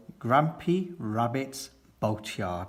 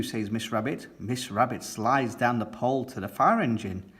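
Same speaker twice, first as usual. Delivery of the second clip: ordinary delivery, nothing marked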